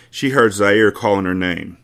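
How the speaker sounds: ordinary delivery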